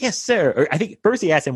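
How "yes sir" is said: put-on much higher boyish voice; boy answering. Bean Bunny answers Scrooge in a high squeaky voice. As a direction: pipe up like a little boy. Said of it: "And he's like".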